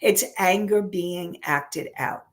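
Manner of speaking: ordinary delivery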